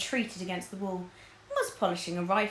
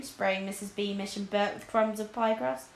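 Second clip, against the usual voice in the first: plain reading voice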